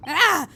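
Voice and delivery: loud, high shout of alarm; sudden acted cry of shock; startled yell. Player voices the shocked prisoner, startled yell. Character startled, cannot move.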